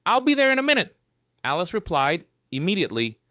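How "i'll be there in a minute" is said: high, loud frightened reply; scared. Acted. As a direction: answer in a scared rush. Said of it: "her voice sounding scared".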